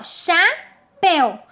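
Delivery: CHAPÉU slowly as "cha... péu"; two drawn-out beats on chapéu, the éu kept as one; split into syllables. Shown as a demonstration. Teacher giving an example of syllable division, split syllables. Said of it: "juntas em uma separação silábica".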